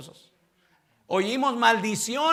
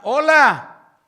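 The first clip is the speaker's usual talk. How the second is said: curt greeting; 6 semitones higher, 11 dB louder than usual. Retold or imitated. Curt greeting to old friends.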